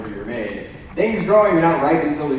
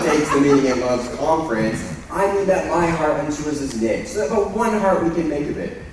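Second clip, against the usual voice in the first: normal talking voice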